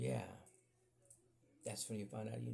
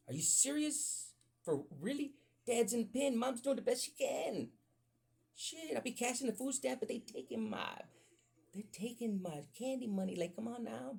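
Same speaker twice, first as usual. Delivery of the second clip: much higher, whining complaint; whiny kid voice; 11 semitones higher than usual. Retold or imitated. Kid complaining about his home life, high whine. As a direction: squeak it like a put-upon little kid.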